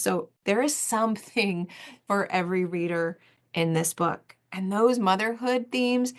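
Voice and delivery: normal talking voice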